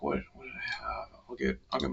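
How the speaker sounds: breathy half-whisper; whispering while thinking; whispered to himself. Real, not acted.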